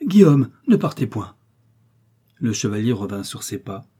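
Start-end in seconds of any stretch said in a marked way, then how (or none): 0.1-1.3 loud, high cry to stop him; urgent, raised-voice plea; heartfelt exclamation. A narrator's reading. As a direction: call out from the depths of the heart. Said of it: "une exclamation profonde".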